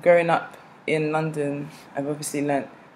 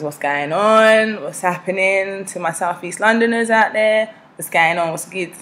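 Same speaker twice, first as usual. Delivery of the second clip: South East London street greeting; South East London accent; 4 semitones higher, 5 dB louder than usual. Shown as a demonstration. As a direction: hail your South East London friends in their slang. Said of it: "I grew up in South East London".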